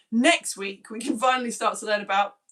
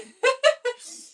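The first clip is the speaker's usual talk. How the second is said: loud high-pitched giggle; sudden burst of high laughter; 8 dB louder than usual. Real, not acted. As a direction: giggle out loud mid-sentence. Laughing while reading comments.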